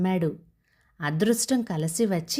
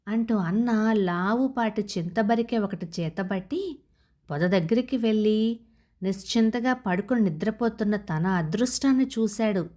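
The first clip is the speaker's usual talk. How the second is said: ordinary delivery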